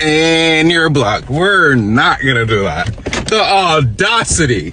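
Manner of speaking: put-on Valley Girl accent. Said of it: "this Valley Girl accent".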